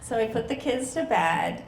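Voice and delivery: normal talking voice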